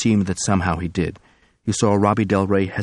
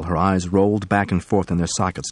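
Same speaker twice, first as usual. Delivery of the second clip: plain reading voice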